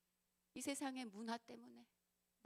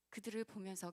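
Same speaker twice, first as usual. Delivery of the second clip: usual speaking voice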